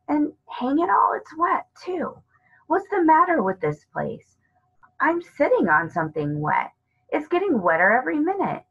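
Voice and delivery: put-on a boy suddenly finding he's sitting in wet; Peter, alarmed.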